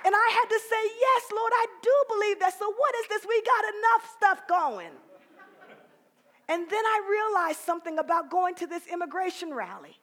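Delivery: loud, high, soaring preacher climax; full-force preaching. Real, not acted. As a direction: build it up and preach it out.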